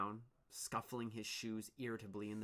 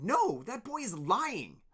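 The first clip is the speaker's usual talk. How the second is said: denial; 12 semitones higher, 10 dB louder, slower than usual. Acted. Dune denies.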